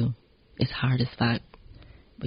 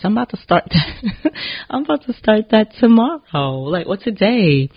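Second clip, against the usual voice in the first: laughing; 9 semitones higher, 5 dB louder than usual. Real, not acted. Laughing at own idea.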